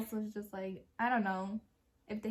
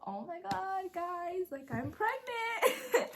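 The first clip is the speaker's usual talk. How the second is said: excited confession; 9 semitones higher, slower than usual. Retold or imitated. Confessing pregnancy to her sisters.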